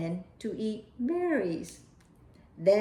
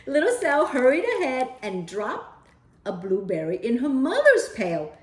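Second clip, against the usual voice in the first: ordinary delivery, nothing marked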